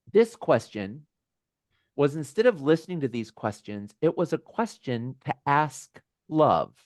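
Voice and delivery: usual speaking voice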